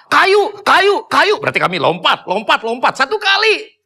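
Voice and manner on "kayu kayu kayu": high, loud calls in a quick string; repeated warning yells. Retold or imitated. Hiker yelling warnings to the group behind, repeated high shouts. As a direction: keep hollering the warning up high. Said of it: "cuma dengar suaranya doang".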